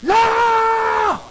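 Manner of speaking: high, loud shouted no; mob shout. Retold or imitated. Preacher voicing the mob shouting down Stephen. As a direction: yell it like a furious crowd. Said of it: "They're like".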